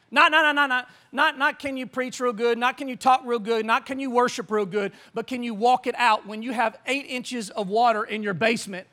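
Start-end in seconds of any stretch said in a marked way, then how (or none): none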